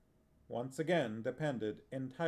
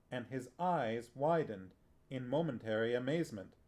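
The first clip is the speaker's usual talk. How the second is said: ordinary delivery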